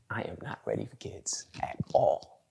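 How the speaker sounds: hushed, emphatic whisper; whispered admission. Retold or imitated. Uncle admitting he isn't ready for kids, whispered admission. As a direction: whisper it like a grave confession.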